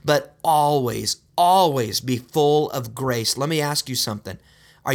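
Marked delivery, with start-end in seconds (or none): 0.0-3.4 always drawn out slowly and firmly; slow right down and press each always; slow, insistent repeat of always; stress on always. Shown as a demonstration. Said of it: "not sometimes".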